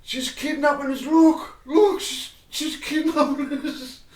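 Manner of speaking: high, loud cry for attention; mock shouting. Customer shouting to a full shop about being pulled along, mock shouting. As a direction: shout out in playful mock alarm. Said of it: "So I start shouting".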